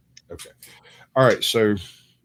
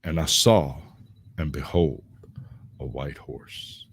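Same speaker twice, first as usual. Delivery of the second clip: scripture reading; 4 semitones lower, 4 dB louder than usual. Acted. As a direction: read it like doom is coming.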